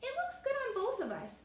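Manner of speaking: voiced as a turtle realizing unfairness; thoughtful turtle.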